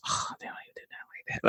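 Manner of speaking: very quiet, quick whispered grumble; mumbling under breath. Acted. Husband watching medical shows beside his wife, mumbling under his breath. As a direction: mutter it fast in a whisper, barely audible. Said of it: "I just be mumbling under my breath".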